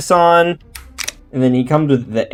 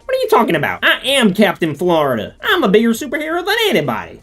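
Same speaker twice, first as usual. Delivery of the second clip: high, fast bragging Florida man; boastful Captain Florida; 8 semitones higher, faster than usual. Acted. Toy collector voicing a figure, high boastful brag. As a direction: puff up and brag like a self-made hero.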